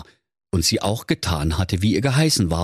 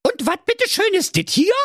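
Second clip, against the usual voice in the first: very high, squeaky indignant retort; Inge calling out. Acted. She-hedgehog showing the hare her legs, squeakily indignant. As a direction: throw it back in a piping voice. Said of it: "rief die Mettigelin Inge".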